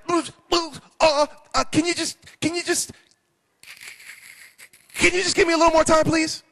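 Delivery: high, stammering, frightened plea; scared debtor. Acted. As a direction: squeak out a trembling-lipped plea to the king. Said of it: "and he's all scared".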